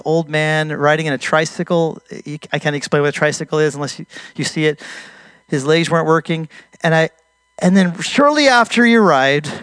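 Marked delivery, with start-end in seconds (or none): none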